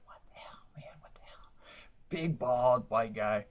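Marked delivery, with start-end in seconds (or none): 0.1-1.5 breathy whispered what the hell; hushed, disbelieving whisper; mock whisper. Comic whispering to himself in disbelief. Whispered retold outburst on stage.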